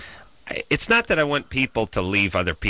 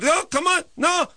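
pushy falsetto Israeli neighbour; horrible Israeli accent. Retold or imitated. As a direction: badger him sky-high in a mock Israeli accent. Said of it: "A horrible accent".

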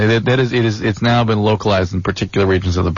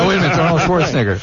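mock surprise; 8 semitones higher, faster than usual. Playing the clerk on the phone.

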